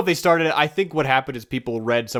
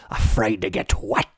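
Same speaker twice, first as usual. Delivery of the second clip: deeper put-on sea-devil voice; Davy Jones; 2 semitones lower, slower than usual. Shown as a demonstration. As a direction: taunt in a villain's character voice. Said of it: "It's a bit deeper".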